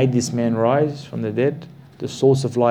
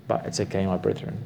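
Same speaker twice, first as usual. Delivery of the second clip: gentle reassurance; 3 semitones lower, 7 dB quieter, faster than usual. Acted. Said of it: "softening toward the listeners".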